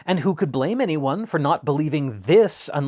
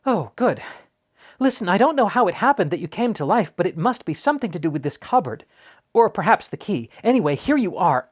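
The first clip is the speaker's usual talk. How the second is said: put-on fast, high, eager boy's pleading; very quickly; 7 semitones higher, 4 dB louder than usual. A narrator's reading. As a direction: rush it all out, hopeful and nervous. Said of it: "He said this all very quickly".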